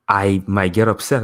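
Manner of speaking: ordinary talk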